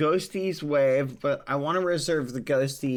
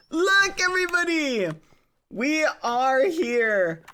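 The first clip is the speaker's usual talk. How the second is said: cute anime streamer; 12 semitones higher than usual. Acted. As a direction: squeak it out like an anime girl. Squeaky cute announcement.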